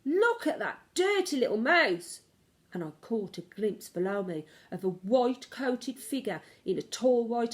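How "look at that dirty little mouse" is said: high, loud alarmed yell; man yelling. Acted. Chef spotting the mouse overhead, yelling loud and high. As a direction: scream it out in alarm. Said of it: "a man's voice yelling".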